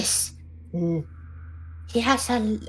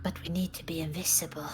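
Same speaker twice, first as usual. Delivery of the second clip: whisper; 4 semitones lower, 8 dB quieter, faster than usual. Real, not acted. Whispering to stay hidden.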